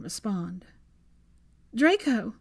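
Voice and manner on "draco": louder call. Acted. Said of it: "she said louder".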